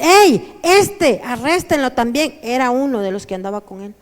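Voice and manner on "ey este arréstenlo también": sudden loud call to arrest him, pitched high; woman calling out. Acted. Woman in the crowd calls to arrest Peter, loud high shout. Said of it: "Y dice".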